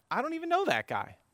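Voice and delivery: high-pitched protest of innocence; mock denial. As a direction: deny it with the pitch leaping up. Peter's denial, acted.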